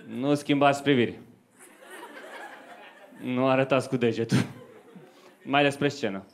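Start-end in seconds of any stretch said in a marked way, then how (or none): none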